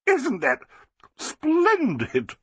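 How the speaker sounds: high, gushing society lady; Flossie, delighted. A narrator's reading. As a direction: crow with triumph, slowing at the end.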